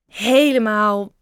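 slow, loud, drawn-out stalling; stammered. A narrator's reading. Bob dodging the larvae's camping invitation, stammering. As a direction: stretch the word while hunting for an excuse. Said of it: "stamelde Bob".

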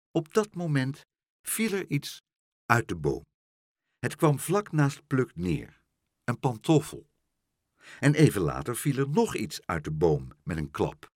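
ordinary delivery, nothing marked

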